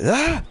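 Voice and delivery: sudden high loud yelp; imitated sleep yell. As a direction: mimic a sharp yell from a nightmare. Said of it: "What sort of scream?".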